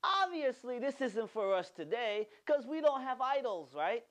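high, mocking know-it-all tone; mock dismissive. Pastor mimicking people who brush off the command, mocking. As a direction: lightly scoff at the idea. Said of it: "we're like".

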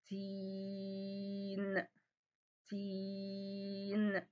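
"تين" very slow, the long ee held level; stretched vowel. Shown as a demonstration. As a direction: hold the ee in "تين" on one steady note. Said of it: "أنتِ قعدتِ تمدي في صوت الحرف".

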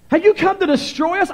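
high-pitched reading of the evil spirit's cry. A narrator's reading. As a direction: cry it out high, without the monster growl. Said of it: "evil spirit cried out".

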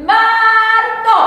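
Marzo called out loud and high; nice and loud. Shown as a demonstration. Teacher asks the class to say Marzo nice and loud. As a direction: belt out Marzo for the whole class. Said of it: "this is you all nice and loud for me".

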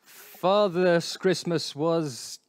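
normal talking voice

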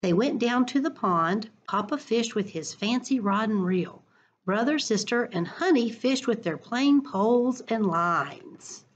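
expressive picture-book read-aloud; reading to children. Acted. Teacher reading a picture book to her class, lively storytime voice.

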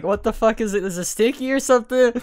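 ordinary delivery

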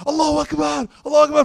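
high, very loud ecstatic cry; screaming Allahu Akbar. Retold or imitated. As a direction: imitate a crowd going crazy, screaming it out. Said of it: "they're screaming".

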